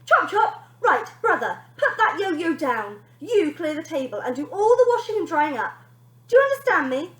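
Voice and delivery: high, loud bratty commands; bossy order. Acted. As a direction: boss the little brother around. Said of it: "Bonnie ordered".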